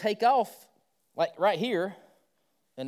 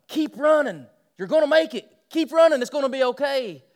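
higher, louder shouts to keep running; calling encouragement; 7 semitones higher, 7 dB louder than usual. Retold or imitated. Teenage boy calling to a younger runner, loud encouraging shouts. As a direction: cheer her on as she passes. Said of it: "he was going".

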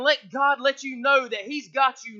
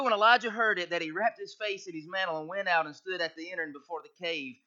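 usual speaking voice

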